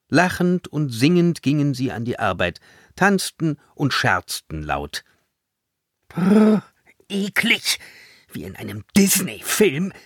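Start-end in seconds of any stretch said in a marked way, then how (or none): 6.1-9.9 shuddering, high-pitched revulsion; hammed-up "yuck" with a shiver; disgusted. A narrator's reading. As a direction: recoil from the sickening sweetness. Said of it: "sagte Sanja angewidert".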